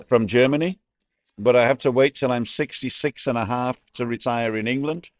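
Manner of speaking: ordinary delivery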